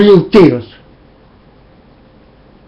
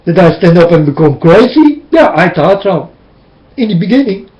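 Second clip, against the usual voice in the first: normal talking voice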